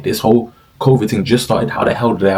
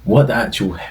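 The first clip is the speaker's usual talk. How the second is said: near-whispered, softer disbelief; inner thought; 7 dB quieter than usual. Retold or imitated. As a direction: mutter it under your breath in disbelief. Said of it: "I used to think".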